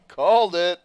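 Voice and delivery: triumphant. Real, not acted. Gamer gloating over a correct prediction, triumphant shout. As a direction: crow it out, you were right.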